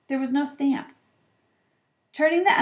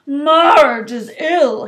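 gruff uncle; 4 semitones higher, 10 dB louder, much slower than usual. A narrator's reading. Uncle reads postcard.

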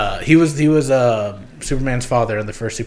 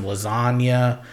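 "lasagna" said slowly in a mock Italian accent, each syllable stretched; drawn-out Italian-accented "lasagna"; much slower than usual. Shown as a demonstration. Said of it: "that was my Italian accent for lasagna".

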